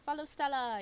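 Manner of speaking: high, loud rallying call; loud, high-pitched battle cry to follow Stella; cheerful shout. Acted. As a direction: cheer the group onward.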